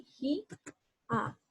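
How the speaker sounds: "ji" held and rising, then a detached "a"; separated syllables. Shown as a demonstration. Teacher showing the hiatus, "ji" and "a" as separate syllables. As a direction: pause cleanly between "ji" and "a". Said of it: "Pronuncio la A en otra sílaba".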